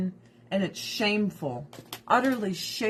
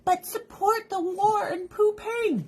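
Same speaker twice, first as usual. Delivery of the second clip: mocking; 9 semitones higher, slower than usual. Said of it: "the people that are like".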